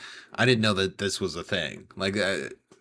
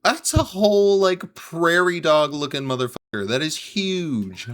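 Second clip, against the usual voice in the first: amazed exclamation; 9 semitones higher, 5 dB louder than usual. Real, not acted. Shocked at huge rat.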